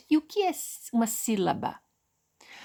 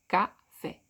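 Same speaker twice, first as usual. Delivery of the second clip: "Café" split as Ca, fé, lower; syllable by syllable; 4 semitones lower than usual. Shown as a demonstration. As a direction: count out "Café" in two separate beats.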